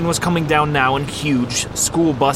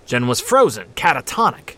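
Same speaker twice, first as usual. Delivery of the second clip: ordinary delivery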